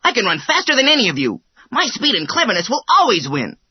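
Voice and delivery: high, quick, cocky hare; boastful hare voice. A narrator's reading. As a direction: brag like a fast young hare.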